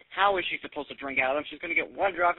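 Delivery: normal talking voice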